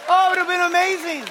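thrilled at the imagined scene; excited. Real, not acted. Excited imagining rock concert.

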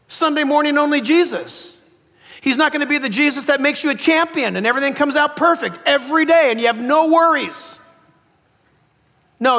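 loud, building sermon delivery; impassioned preaching. Real, not acted. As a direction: press each point home to the congregation. Preaches with rising force.

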